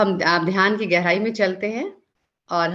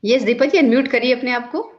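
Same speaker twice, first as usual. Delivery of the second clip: raised, higher calling voice; 5 semitones higher, 4 dB louder, faster than usual. Real, not acted. Host telling the muted guest to unmute, calling out. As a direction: call up brightly to someone who can't be heard.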